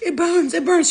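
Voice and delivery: high, loud whiny wail; mock pained cry. Retelling the chemical peel burning her skin, high and loud. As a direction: cry out like your face is on fire.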